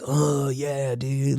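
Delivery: slow, drawn-out Californian dude; easygoing California slacker accent; laid-back Californian. Retold or imitated. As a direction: stretch it out like a mellow Californian. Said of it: "like, all slow".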